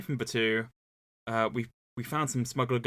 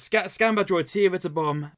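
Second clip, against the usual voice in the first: commanding shout; 7 semitones higher, 7 dB louder than usual. Acted. Duke barking orders at droids.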